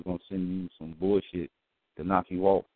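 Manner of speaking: ordinary delivery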